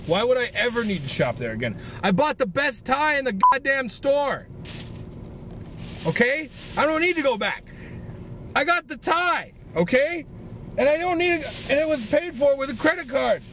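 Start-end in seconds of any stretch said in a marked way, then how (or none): 2.0-13.4 worked-up rant. Real, not acted. Worked-up rant about the tie.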